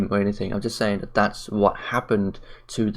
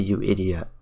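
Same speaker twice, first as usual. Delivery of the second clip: ordinary delivery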